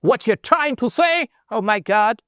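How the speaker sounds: high, drawn-out put-on American accent; mock American accent. Shown as a demonstration. Teacher demonstrating how Americans talk, mock American accent. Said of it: "तो American accent जैसे".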